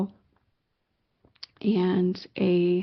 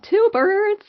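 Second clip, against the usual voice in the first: high, loud squeal of disbelief; re-enacted thrilled exclamation; amazed excitement; 13 semitones higher, 6 dB louder than usual. Retold amazement at second sighting.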